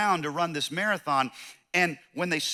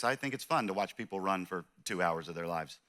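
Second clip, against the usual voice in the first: usual speaking voice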